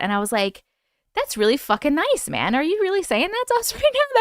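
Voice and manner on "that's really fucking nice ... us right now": retold delighted disbelief. Retells own delighted reply to actor.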